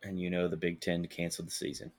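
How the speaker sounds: low, quiet and downcast; sad voice. Acted. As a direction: say it like you're heartbroken. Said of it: "that's my sad voice".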